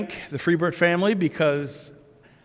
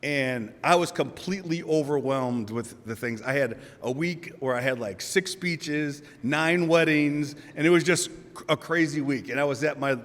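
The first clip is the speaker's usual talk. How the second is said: ordinary talk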